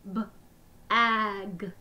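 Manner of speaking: each sound said separately with a short break; sounds tapped apart. Shown as a demonstration. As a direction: split the word into its sounds, one by one. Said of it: "Let's tap it again".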